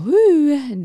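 high, loud "whoo" of relief; relieved whoo. Retold or imitated. Actress after dodging a bullet, relieved whoop.

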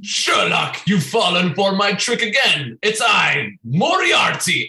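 hammed-up villain's reveal, shouted; Moriarty. Flatmate unmasks to Sherlock, hammed-up triumphant villain shout. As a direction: crow it theatrically, stretching out the name.

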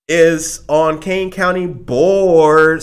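slow, grand announcer build-up; hype announcer. Acted. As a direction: boom it out like an intro. Hyping guest's title.

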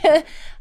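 a quick, high, amused laugh; high burst of laughter. Real, not acted. Laughing at followers' surprise, a high amused laugh. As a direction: laugh it off brightly.

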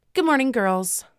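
high, singsong wake-up greeting; high-pitched morning call; mock-cheery greeting. A narrator's reading. Captor wakes the captives.

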